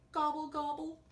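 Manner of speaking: high gobbling turkey, lightly grumbled; grumbling turkey. A narrator's reading. Turkey told he is too short, high mock-grumbled gobble. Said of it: "grumbled Turkey".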